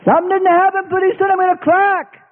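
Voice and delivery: high, whiny pleading voice; high whining plea to the Lord; impatient believer. Acted. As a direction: nag like a child in the fire.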